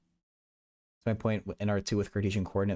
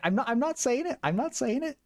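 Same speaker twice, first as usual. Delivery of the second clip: high-pitched insistence. Real, not acted. Streamer refusing chat any spoilers, high-pitched playful protest. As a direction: voice shoots way up into a squeaky register.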